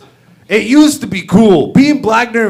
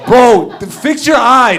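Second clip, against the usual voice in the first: ordinary talk, nothing marked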